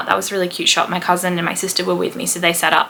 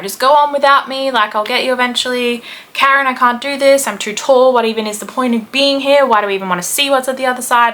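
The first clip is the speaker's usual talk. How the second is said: higher, louder hammed-up complaint; mock exasperated whining; 5 semitones higher, 3 dB louder than usual. Tall man begging off the tunnel tour to his wife, mock exasperated. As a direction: complain like a husband who has given up.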